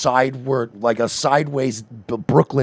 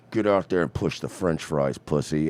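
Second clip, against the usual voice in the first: menacing handler; 11 semitones lower, 9 dB quieter than usual. Retold or imitated. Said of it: "makes you go".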